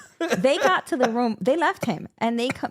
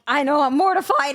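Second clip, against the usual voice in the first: a touch higher, embarrassed; imitating a mortified husband; 5 semitones higher than usual. Wife quoting her embarrassed husband, mild mortified tone. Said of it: "he's like".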